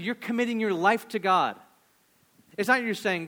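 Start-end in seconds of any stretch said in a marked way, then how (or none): none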